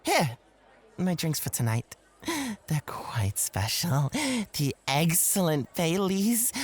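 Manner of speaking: usual speaking voice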